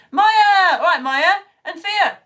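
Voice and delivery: high, loud bellowed greeting; bellowing. Real, not acted. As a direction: bellow the names into the phone. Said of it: "I'm just bellowing now into my phone".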